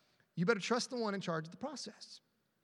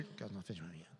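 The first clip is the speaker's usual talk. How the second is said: barely audible, much softer mumble; mumbled under breath; 13 semitones lower, 15 dB quieter than usual. Shown as a demonstration. Pastor shows the congregation how to mumble it, very soft. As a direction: murmur it to yourself under your breath. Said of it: "Just go mumble under".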